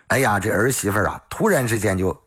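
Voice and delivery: ordinary delivery, nothing marked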